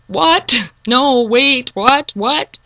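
high, loud shocked cries of disbelief; reliving the jolt of not believing it; total disbelief. Retold or imitated. To herself on discovering her memory is gone, stunned disbelief. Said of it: "actually saying out loud".

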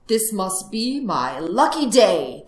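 loud, drawn-out delight; storybook fox crowing over luck; exultant shout. Acted. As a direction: proclaim it slowly and gleefully. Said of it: "the fox shouted".

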